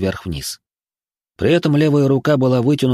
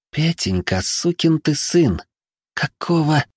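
slow, higher hissed-out fury; hateful whisper; 7 semitones higher, slower than usual. A narrator's reading. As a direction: spit it out slowly with hatred. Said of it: "прошептала главный бухгалтер с глазами полными ненависти".

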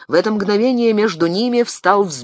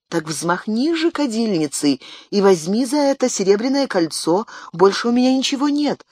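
pleading; 7 semitones higher than usual. Acted. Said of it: "умоляла женщина".

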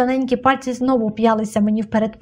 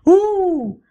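high drawn-out howl; piercingly high holler; sudden howl; 9 semitones higher, 4 dB louder than usual. A narrator's reading. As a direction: wail a long, high "oo". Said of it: "раптом заволала Лена".

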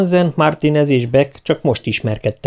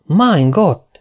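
shocked exclamation; 7 semitones higher than usual. A narrator's reading. Vogel, stunned by the file, cries out in alarm. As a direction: blurt it out as the realisation hits. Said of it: "kiáltott fel".

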